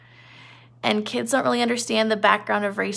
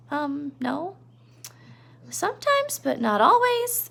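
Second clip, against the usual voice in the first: put-on a higher, hesitant childlike Lenny; shy and unsure; 5 semitones higher, slower than usual. Lenny admitting to Crooks he doesn't always understand, higher and hesitant. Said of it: "Lenny's like".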